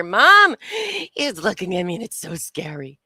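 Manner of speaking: put-on loud whiny child's Mom; whiny kid. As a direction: whine like a tattling kid. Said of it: "It's like the kids in the back of the car".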